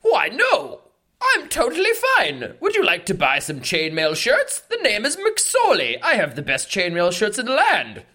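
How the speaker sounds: high-pitched chainmail seller's pitch; squeaky-high salesman insisting all is fine; insistently cheerful shopkeeper. Acted. Voices chainmail seller McSorley.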